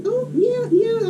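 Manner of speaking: high, soft accepting lady voice; agreeable lady. Retold or imitated. Lady accepting the boy's apology, agreeable. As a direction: imitate a kindly lady, light and high. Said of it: "She's like".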